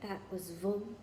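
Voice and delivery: a soft, strangled half-word; the name catching in a frightened throat; croaking. A narrator's reading. Harry croaking out the dark lord's name to Firenze. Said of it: "Harry croaked".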